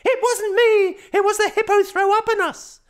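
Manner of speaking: put-on high, loud little boy protesting; child voice. A narrator's reading. Boy protesting innocence to dad, high loud child voice. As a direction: insist like a small boy caught out. Boy insisting to his father.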